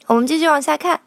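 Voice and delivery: normal talking voice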